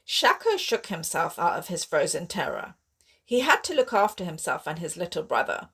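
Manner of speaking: ordinary delivery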